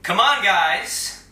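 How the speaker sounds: loud, slow call to his crew; beckoning his gang in, loud and slow. Acted. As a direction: summon the team with a drawn-out call. Villain summons his team in.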